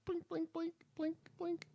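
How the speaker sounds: tiny, very high falsetto; cutesy high-pitched blinks; squeaky blinking. Pretend. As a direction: squeak each word softly like a cartoon effect. Playing big-eyed deer batting lashes.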